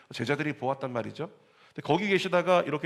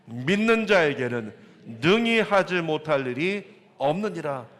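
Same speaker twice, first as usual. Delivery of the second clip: solemn scripture read; 2 semitones higher, 6 dB louder, slower than usual. Acted. Reading Jesus' words solemnly.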